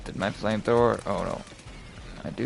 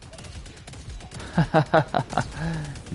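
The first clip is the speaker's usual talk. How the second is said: high breathy burst of laughter; real laugh; 6 semitones higher than usual. Real, not acted. Laughs at strange sight.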